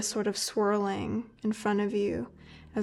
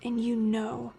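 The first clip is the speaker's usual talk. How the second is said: game master narration. A narrator's reading. GM scene narration.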